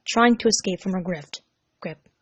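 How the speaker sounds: usual speaking voice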